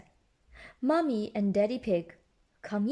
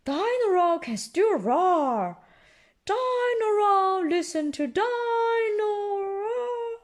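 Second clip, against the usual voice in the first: higher, slow cheerful singsong; bright Mommy Pig; 10 semitones higher, 3 dB louder, slower than usual. Acted. Mother cheering her sad son, bright slow singsong. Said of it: "says Mommy Pig brightly".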